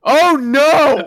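loud, high "oh no"; shocked shout. Real, not acted. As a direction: cry out in shock. Reacting to the Christmas story.

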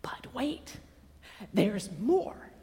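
very soft, drawing them in; hushed teaser. Acted. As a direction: hold it back, almost secretive. Hushed repeat of the earlier line.

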